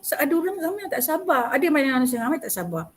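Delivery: ordinary talk, nothing marked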